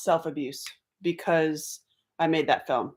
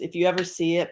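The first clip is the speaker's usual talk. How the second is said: ordinary talk, nothing marked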